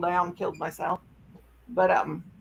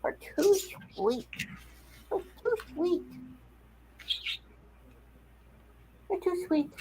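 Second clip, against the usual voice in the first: much higher and softer cooing; 9 semitones higher, 9 dB quieter than usual. Real, not acted. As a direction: coo softly. Baby talk to dog.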